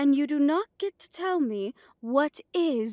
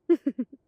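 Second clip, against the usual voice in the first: a short, louder mocking laugh; menacing laugh; 2 semitones higher, 6 dB louder than usual. Coven leader scoffing at the outsider, a short loud mocking laugh. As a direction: laugh at him like he's a fool.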